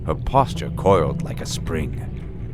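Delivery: plain reading voice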